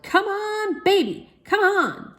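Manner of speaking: a high football-fan yell. A narrator's reading. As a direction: cheer like at a football game. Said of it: "Daddy yelled".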